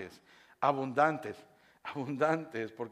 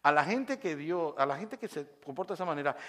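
normal talking voice

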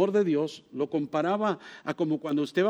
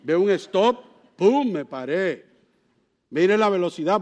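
ordinary talk, nothing marked

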